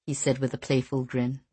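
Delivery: ordinary delivery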